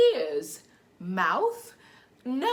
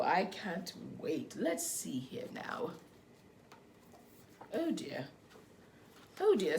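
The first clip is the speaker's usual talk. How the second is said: put-on reading to children; 3 semitones higher, slower than usual. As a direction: read gently to little ones. Reads picture book to children.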